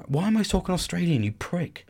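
put-on half-hearted Australian accent; softly muttered, botched Australian accent; attempted Australian accent. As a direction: slip by accident into Australian. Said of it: "I tried to go Australian".

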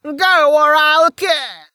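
high, loud crone's scream; threatening shriek. Acted. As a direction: scream a threat, hostage in hand. Old woman threatens with a hostage.

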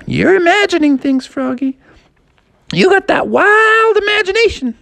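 high, teasing sister voice. Acted. Sister teasing Froggy, high voiced. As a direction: tease him way up high. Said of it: "And she's like".

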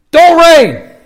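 sharp high shout at the sky; mock command shout. Elijah commanding the sky, shouting upward. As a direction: bark it up at the heavens.

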